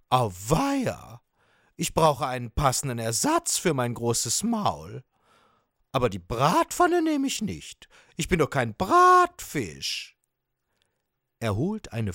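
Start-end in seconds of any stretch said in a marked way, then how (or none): 0.1-10.2 comic indignation, pitch leaping up high; higher, huffy sawfish voice; dismayed sawfish. Acted. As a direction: protest like an offended cartoon fish.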